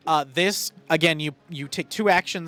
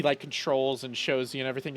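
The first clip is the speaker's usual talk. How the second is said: usual speaking voice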